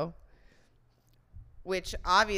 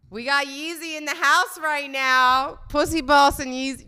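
higher, louder mock announcer hype; hype shout-out; 7 semitones higher, 7 dB louder than usual. Host welcoming [name] into the show, hype shout-out. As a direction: announce a guest to the house, loudly. Hyped shout-out to arriving guest.

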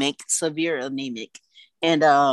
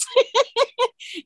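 delighted high laugh. Real, not acted. Joy at baby's eyes.

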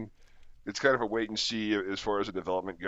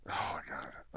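embarrassed whisper under breath; 7 semitones lower, 9 dB quieter than usual. Real, not acted. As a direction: mutter it to yourself, whispered. Embarrassed aside.

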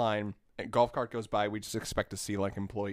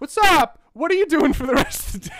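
perky employee voice; 12 dB louder than usual. Retold or imitated. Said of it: "and she said".

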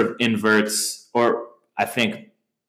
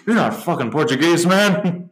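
higher put-on voice; imitated Gonzo; 9 semitones higher than usual. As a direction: toss it off in a character voice.

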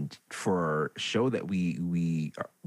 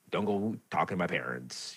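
ordinary delivery

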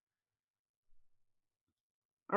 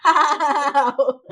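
laughing, louder question; 3 semitones higher, 7 dB louder than usual. Real, not acted. Wife laughs as she corrects herself from what to how, louder.

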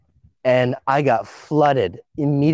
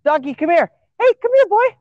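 high, louder coaxing call; calling the dog; 14 semitones higher, 4 dB louder than usual. Real, not acted. Friend calls a runaway dog to come back, high loud call. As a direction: sing out to a dog far away.

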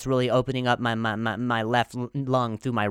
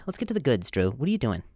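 normal talking voice

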